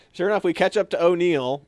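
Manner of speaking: ordinary talk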